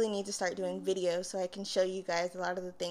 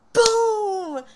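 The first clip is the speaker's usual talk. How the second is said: loud, high imitated crash; 13 semitones higher, 13 dB louder than usual. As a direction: shout out the bang of a fall. Imitates the crash she hears.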